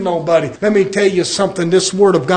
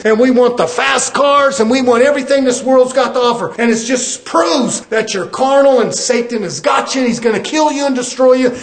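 fiery preaching; 5 semitones higher than usual. Acted. Preacher warning his brothers and sisters, full-force fiery preaching. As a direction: preach it hard at the congregation, building toward hell.

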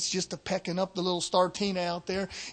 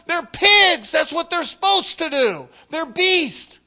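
raised, high-pitched preaching voice; forceful preaching; 7 semitones higher, 6 dB louder than usual. Real, not acted. Preacher to the church about the pigs of the world, forceful preaching. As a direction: preach it hard, pitch pushed up.

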